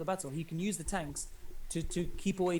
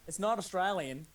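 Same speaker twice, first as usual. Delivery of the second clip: quick Australian accent, lightly done. Shown as a demonstration. Caster shows his co-caster an Australian accent. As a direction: toss off the line in a hint of Australian. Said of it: "you're very good at doing Australian accent".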